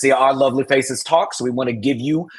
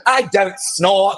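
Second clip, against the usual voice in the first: "I don't snore" stretched very slowly in a hammed-up put-on accent; mock accent impression; 4 semitones higher, much slower than usual. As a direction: redo the denial, slower and in a bigger fake accent. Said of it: "she got that accent".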